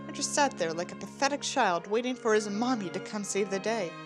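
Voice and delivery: usual speaking voice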